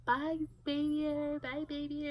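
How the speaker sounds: put-on sing-song goodbye like a toddler; daycare kids saying bye. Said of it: "They'll say bye to him".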